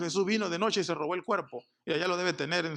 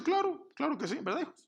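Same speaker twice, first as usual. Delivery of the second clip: much higher, eager mother's voice; a mother overselling her sons' readiness; 10 semitones higher than usual. Retold or imitated. Mother pleads for her sons.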